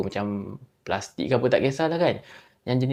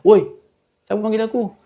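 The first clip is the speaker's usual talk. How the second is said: loud, higher yell down the empty hall; 9 semitones higher, 9 dB louder than usual. Retold or imitated. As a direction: demand to know who called. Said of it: "Lepas tu dia jerit lah". (berteriak keras)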